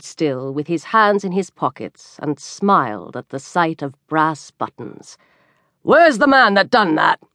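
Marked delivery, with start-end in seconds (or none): 5.8-7.2 excitedly demanding police officer; worked-up officer's urgent question. A narrator's reading. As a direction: bark it out like an agitated officer. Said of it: "inquired the officer excitedly".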